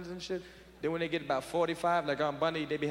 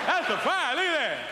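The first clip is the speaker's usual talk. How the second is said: laughing; 9 semitones higher, 5 dB louder than usual. Retold or imitated. Uncle laughing at the blaze.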